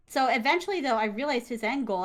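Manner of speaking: ordinary talk, nothing marked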